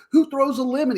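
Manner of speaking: high-pitched mock outrage; incredulous retold outburst, voice jumping up; exploding in outrage. Bar quarrel over thrown lemon, high incredulous mock outrage. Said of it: "it's, like, exploding".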